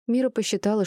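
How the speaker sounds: plain reading voice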